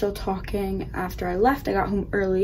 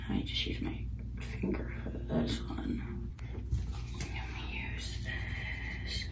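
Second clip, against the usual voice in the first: whispering; 11 dB quieter, slower than usual. Real, not acted. Whispering during makeup.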